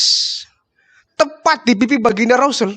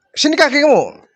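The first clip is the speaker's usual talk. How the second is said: higher, urgent request; caring command; 7 semitones higher than usual. Acted. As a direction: call for the foot, concerned. Prophet asks for the foot. (perintah penuh perhatian, lebih tinggi)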